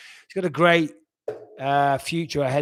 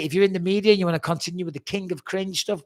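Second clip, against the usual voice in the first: ordinary talk, nothing marked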